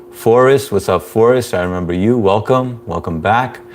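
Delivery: normal talking voice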